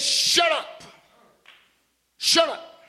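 loud, high barked order; retold shout. Clinic man silencing the preacher, shouting.

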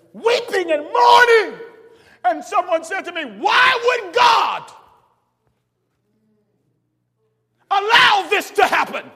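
loud, high, drawn-out preacher's cry; full-voiced sermon climax; preaching at full force. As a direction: lift it up and holler it to the church. Preacher builds to a shout.

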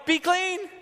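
high, loud triumphant command; exultant command; Jesus commanding. Retold or imitated. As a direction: ring it out as a healing word.

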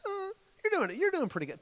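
very high, wavering mock reassurance; evasive doctor. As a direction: squeak out hedged comfort as a nervous doctor. Acts evasive doctor sugar-coating bad news.

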